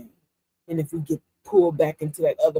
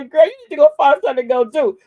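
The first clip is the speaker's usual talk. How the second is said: sassy retort; 6 semitones higher, 11 dB louder, faster than usual. Retold or imitated. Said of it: "I wanted to tell her".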